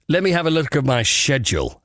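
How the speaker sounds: British "schedule" starting like "shed"; upper-crust schedule. Shown as a demonstration. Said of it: "sounds as obnoxiously upper crust".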